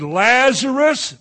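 Lazarus drawn out slow, high and loud; long ringing summons; drawn-out call. Shown as a demonstration. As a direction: call his name across the tomb. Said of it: "I believe he said".

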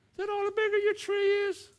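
mocking rival preacher. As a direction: jeer at the other man's tiny tree. Rival preacher mocks smaller tree.

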